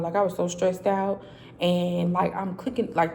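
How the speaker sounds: normal talking voice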